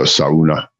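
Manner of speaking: "sauna" as SAH-oo-nah, both vowels sounded; Finnish vowels. Shown as a demonstration. Explaining how Finns say vowels, "sauna" as SAH-oo-nah. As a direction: draw out "sauna" with "ah-oo" first. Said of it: "they pronounce their vowels like".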